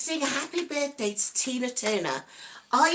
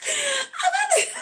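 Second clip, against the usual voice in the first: much higher, laughing through it; laughing squeal; slower than usual. Real, not acted.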